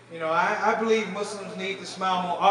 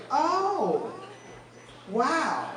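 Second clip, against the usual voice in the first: surprised lady; 5 semitones higher, 4 dB quieter than usual. Retold or imitated. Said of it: "She goes".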